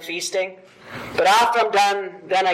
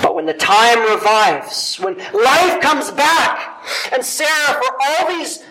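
louder, higher, slow and swelling; rising fervor; 5 semitones higher, 5 dB louder than usual. Acted. Preacher swells, louder and higher, over life returning. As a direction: lift the hall toward spring.

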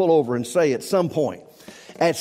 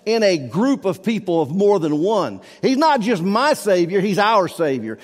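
ordinary talk

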